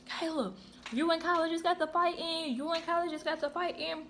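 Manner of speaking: put-on high-pitched eager girls; buzzing with excitement; excited gossip. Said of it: "It was like".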